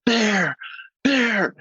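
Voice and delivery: high-pitched mock yell, no real volume; imitated warning yell. Ranger retelling how someone up ahead warned of a bear, mock yell. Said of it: "I hear somebody yell".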